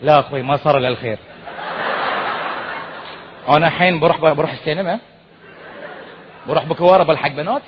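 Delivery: fast nervous backpedaling; backing down. Retold or imitated. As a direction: quickly talk your way out. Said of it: "all of a sudden his tone changed".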